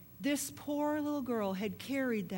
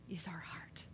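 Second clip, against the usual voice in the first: low breathy whisper on the key words; intimate whisper; 5 semitones lower, 11 dB quieter than usual. Acted. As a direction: lean in and whisper the point. Preacher stressing the heart.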